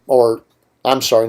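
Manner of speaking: usual speaking voice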